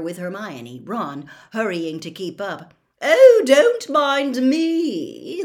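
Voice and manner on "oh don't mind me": loud, high, drawn-out indignation; irritable call. A narrator's reading. Fat lady calling after the children, loud and irritable. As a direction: call after them with slow, loud sarcasm. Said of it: "called irritably after them".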